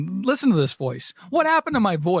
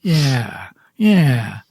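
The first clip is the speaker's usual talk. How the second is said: Al Pacino impression; 4 semitones lower, 5 dB louder, much slower than usual. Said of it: "That's my Al Pacino".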